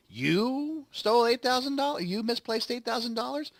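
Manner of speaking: lightly voiced scornful old banker; mocking Potter. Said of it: "He's like".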